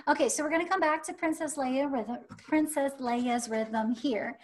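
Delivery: ordinary talk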